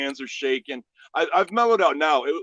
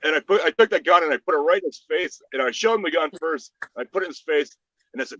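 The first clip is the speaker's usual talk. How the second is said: ordinary talk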